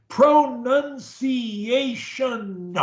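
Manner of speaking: "Pronunciation" very slow and high, every syllable separate; exaggerated pronunciation. Shown as a demonstration. Coach showing a stutterer the sounds, very slow and over-articulated. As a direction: stretch "Pronunciation" out syllable by syllable to the final n. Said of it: "do the pronunciation the same way I do it".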